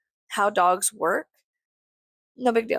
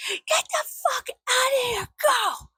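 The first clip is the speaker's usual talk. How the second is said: high shrieked imitation; screaming neighbor. Neighbor chasing off the little potato dog, imitated screaming. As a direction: screech it as the enraged neighbor would. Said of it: "And she's screaming".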